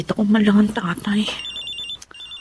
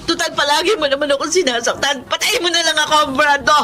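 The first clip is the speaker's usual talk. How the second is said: high, tearful, laughing-crying dare; crying defiance; 6 semitones higher than usual. Acted. Battered woman daring her abusive partner Brando, high tearful crying. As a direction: sob it out as a bitter challenge.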